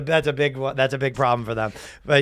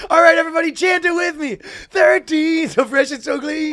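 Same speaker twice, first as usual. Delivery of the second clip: high hype rock frontman; crowd hype; 10 semitones higher than usual. Retold or imitated. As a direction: whip up the crowd. Rocker leading crowd.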